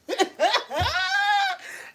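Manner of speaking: long high-pitched squealing laugh. Real, not acted. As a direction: laugh rising very high and held. Laughing at her own point.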